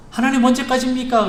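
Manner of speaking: high, loud plea to God; pleading cry. Acted. Preacher voicing his own plea to God, a high pleading cry.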